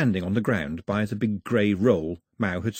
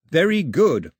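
put-on louder, higher, firm boy insisting; 7 semitones higher, 7 dB louder than usual. A narrator's reading. Boy insisting to the girl her food is good, firm. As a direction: insist it's good against the taste. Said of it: "Mao insisted".

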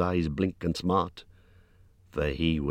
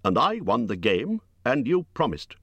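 insistent, raised-pitch protest; crying out; 9 semitones higher than usual. A narrator's reading. Hobbit demands to be shown out as promised, crying out. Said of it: "cried Bilbo".

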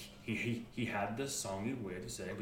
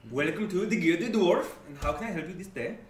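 put-on a Scottish-Swedish accent, louder on the welcome; 4 semitones higher, 8 dB louder than usual. Dwarf greets the customers in a Scottish-Swedish accent. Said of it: "Does this accent sound familiar".

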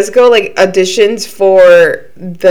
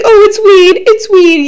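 higher-pitched mimicking of her excuse; mock insistent claim; 12 semitones higher, 4 dB louder, slower than usual. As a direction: parrot her excuse in a high voice. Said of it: "she's like".

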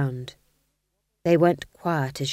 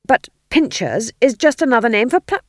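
higher, louder protest cut short; king objecting; 6 semitones higher, 9 dB louder than usual. A narrator's reading. Ghost king objecting then breaking off, loud. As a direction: start to object, then stop dead. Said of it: "the king began".

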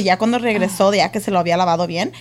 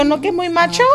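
teasing; 7 semitones higher than usual. Retold or imitated. Mother teasing son who boasted earlier.

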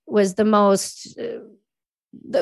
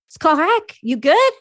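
higher, louder Québécois-tinged check-in; French-Canadian sergeant; 7 semitones higher, 3 dB louder than usual. Retold or imitated.

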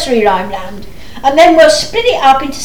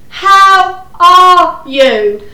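loud, very slow, word by word; shouting to be heard; 5 dB louder, much slower than usual. Acted. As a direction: shout it slowly to someone hard of hearing. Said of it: "No need to shout".

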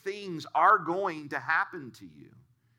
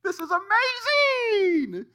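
much higher, louder, slow squeal of delight; excited kid; 7 dB louder, slower than usual. Retold or imitated. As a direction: gush like a thrilled little boy. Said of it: "got all excited".

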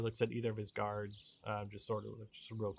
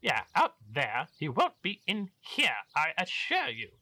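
pompous baron voice; 4 semitones higher, 8 dB louder than usual. Acted.

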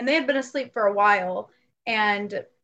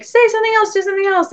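much higher, louder eager calling out; urgent plea; 10 semitones higher, 9 dB louder, much faster than usual. Retold or imitated. Friends calling out to the spirit, urgent. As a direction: beg the voice to come back. Urging the ghost to speak again.

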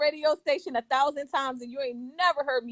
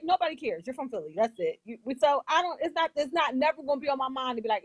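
usual speaking voice

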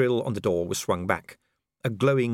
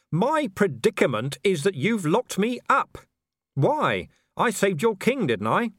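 higher and louder, protesting; an angry commander demanding answers; indignant Vimes; 13 semitones higher, 5 dB louder than usual. A narrator's reading. Vimes protesting to Dee, higher and indignant.

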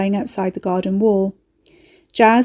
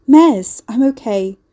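relieved; 3 semitones higher, 7 dB louder, slower than usual. A narrator's reading. Relieved answer to friend.

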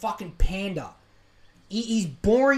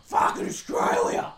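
put-on drunken patriotic yell; 4 dB louder, much slower than usual. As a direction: yell it like a beer-soaked reveller. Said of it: "tinnies just going".

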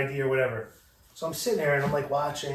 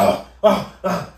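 mock alarmed stop; 4 semitones higher, 8 dB louder, slower than usual. Clerk stopping him loudly.

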